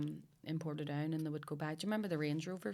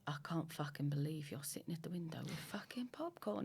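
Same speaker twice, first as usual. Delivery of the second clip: hushed, mock London husband; bad English accent; 9 dB quieter than usual. Husband catching wife at the window, hushed English accent. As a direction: whisper it in a shaky attempt at English. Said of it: "That's a shitty accent, an English accent".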